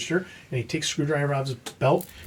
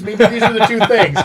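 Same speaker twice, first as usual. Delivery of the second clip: laughing; 6 semitones higher, 9 dB louder than usual. Retold or imitated. Cheeky excuse to the inspector.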